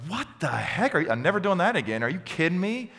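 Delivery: half-joking what-the-heck rant; exasperated outburst. Retold or imitated. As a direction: play up the exasperation of being unthanked. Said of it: "and I'm like".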